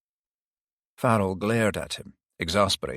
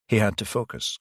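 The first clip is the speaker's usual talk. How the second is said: ordinary delivery, nothing marked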